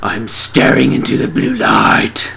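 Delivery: slow, drawn-out comic trance; entranced victim. Retold or imitated. Guy opens curtains, mock entranced. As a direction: intone it with mock drama. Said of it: "he's all like".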